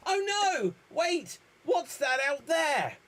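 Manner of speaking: very high shout of surprise; squealing child; excited kid. Acted. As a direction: call out like an amazed child. Child spots the car.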